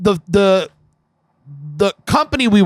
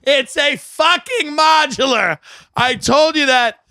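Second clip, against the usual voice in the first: slow, high-pitched mock yell; imitated scream; 5 semitones higher, slower than usual. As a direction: hammer it out like an exasperated screamer. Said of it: "He's screaming".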